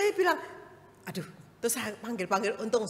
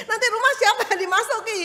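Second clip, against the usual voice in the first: high, loud, fast laughing question; neighbour asking; 12 dB louder, faster than usual. Retold or imitated. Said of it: "tetanggaku itu bilang gini".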